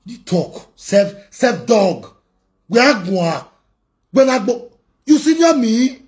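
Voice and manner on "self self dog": slow, stretched insult; drawn-out insult. Real, not acted. Vlogger sneering at an enemy, slow and drawn out. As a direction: drag the insult out.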